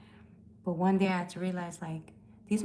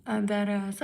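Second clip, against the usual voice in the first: normal talking voice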